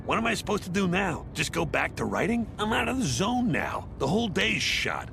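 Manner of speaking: high-pitched, worked-up complaint; exasperated rant. Acted. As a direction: rant as if the whole day is ruined. Father rants over ruined writing day.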